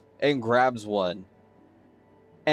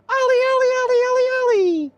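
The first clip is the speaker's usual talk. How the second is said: put-on very high, loud little kid's singsong call; high-pitched child chanting a name; small child calling out; 5 dB louder, faster than usual. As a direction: sing out like a playful child seeking a friend. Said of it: "a small child pops in next to him".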